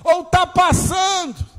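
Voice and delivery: high, loud, shouted sermon; fiery preaching. Acted. Preaching at full force.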